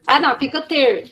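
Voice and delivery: ordinary talk, nothing marked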